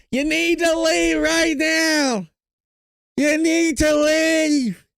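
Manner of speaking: high, slow, shrill mock shout of the line; Karen Bass impression. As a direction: ham up the mayor ordering them out, high and drawn out. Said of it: "I'm gonna do the best impersonation of Karen Bass".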